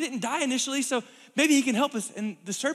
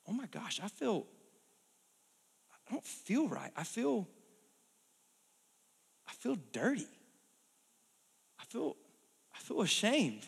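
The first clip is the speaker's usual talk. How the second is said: softer, halting shame; ashamed; 7 dB quieter than usual. Acted. Adam and Eve realising their shame, soft and halting. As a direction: falter as guilt sinks in.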